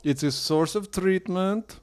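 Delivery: put-on slow heavy Russian accent; deadpan Russian accent, slowly. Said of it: "the Russian accent".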